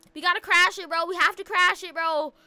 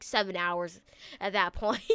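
ordinary delivery

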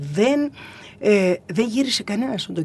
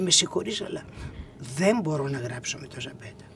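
imitated indignant refusal, soft and low; hushed refusal; 4 semitones lower, 6 dB quieter than usual. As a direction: turn it down in a lowered, half-whispered voice. Retold indignant refusal to write.

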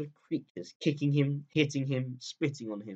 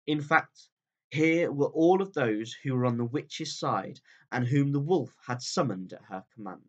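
ordinary delivery

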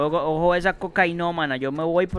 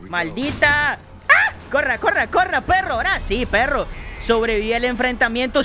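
panicked yelling; 7 semitones higher than usual. Real, not acted. Gamer fleeing a monster, screaming in panic. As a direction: yell like you're running for your life.

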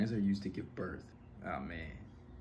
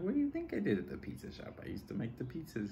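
pitched way up, sarcastic and fed up; exasperated retort; 9 semitones higher than usual. Retold or imitated. To a girl asking about his pizza job, exasperated high retort. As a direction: act like the question is absurd. Annoyed retort to girl's question.

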